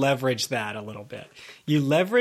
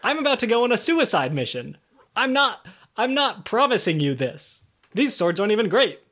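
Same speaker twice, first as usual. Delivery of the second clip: mock indignant protest; 6 semitones higher than usual. Keelan keeps protesting to crone.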